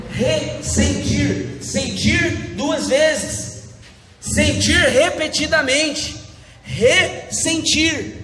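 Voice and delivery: each word stretched very slowly; drawn-out, slow. Shown as a demonstration. Preacher stretching ressentir slowly for the congregation. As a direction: spell out the meaning slowly, word by word. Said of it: "a palavra ressentimento é você sentir de novo".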